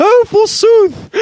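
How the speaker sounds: high, drawn-out theatrical exclamation; mock Shakespearean. Mocking the co-host quoting Shakespeare in tights, theatrically. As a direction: ham it up like a Shakespearean thespian. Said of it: "you keep quoting Shakespeare".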